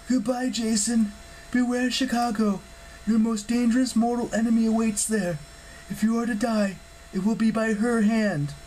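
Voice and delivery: high-pitched parting warning; grave goddess farewell, pitched high; foreboding goddess. Acted. Hera's parting warning to Jason.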